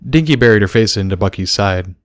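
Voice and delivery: usual speaking voice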